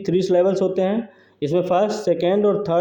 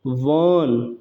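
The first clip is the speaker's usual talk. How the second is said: "वन" isolated, lower and drawn out; stressed syllable; 4 semitones lower than usual. Shown as a demonstration. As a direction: lean long on "वन" to show the stress. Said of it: "ये हो गया stressed".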